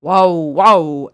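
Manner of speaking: loud, low barking wow wow; sudden loud bark from under the gate; angry dog bark. Acted. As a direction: bark it out like a furious guard dog. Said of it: "a dog said angrily".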